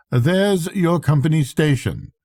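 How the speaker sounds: a weathered captain, matter-of-fact about death; hint of a Swedish captain, dry and grim. Swede points ashore.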